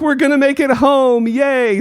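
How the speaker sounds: high, happy relieved cheer; excited cheer. Retold or imitated. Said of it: "and it's like".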